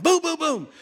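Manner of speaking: high, loud booms in a burst; imitated banging. Telling of noise at five A M, loud mock banging. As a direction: mimic hard pounding on a door. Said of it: "I hear this sound".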